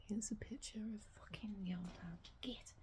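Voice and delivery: hushed whispered grumble; whispering. Real, not acted. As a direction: whisper the complaint under your breath. Muttering at the dog photo.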